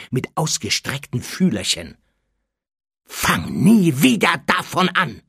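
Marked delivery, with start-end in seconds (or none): 3.0-5.2 loud, slow angry warning; raised, drawn-out threat; furious snarl. A narrator's reading. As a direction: snarl it word by word. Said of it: "fauchte Harry".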